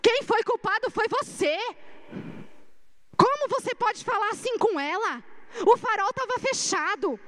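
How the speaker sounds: high-pitched, indignant scolding, retold; Guilherme's indignant defense. Guilherme telling off the taxi driver to defend her, indignant. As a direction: tell the reckless driver off to protect her. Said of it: "Guilherme entrou na minha frente e falou assim".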